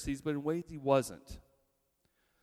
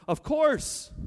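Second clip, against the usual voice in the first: mock eager agreement; 13 semitones higher, 6 dB louder than usual. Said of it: "And the people respond".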